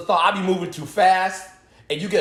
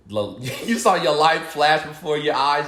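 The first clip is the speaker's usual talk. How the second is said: ordinary talk, nothing marked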